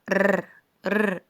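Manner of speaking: ر as an isolated rolled "rrr"; sound of R. Shown as a demonstration. Teacher showing how R is pronounced, held trill. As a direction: trill ر alone, like Arabic raa. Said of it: "حرف الار بتنطق".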